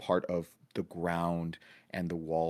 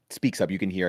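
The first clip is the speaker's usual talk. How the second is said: ordinary talk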